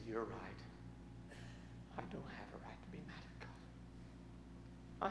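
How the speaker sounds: soft, slow, whispered admission; broken whisper. Retold or imitated. Mad Dog conceding to the pastor, broken whisper. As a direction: barely get the words out, defeated. Broken man admits, softly.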